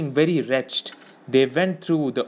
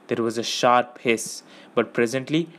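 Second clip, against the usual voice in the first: usual speaking voice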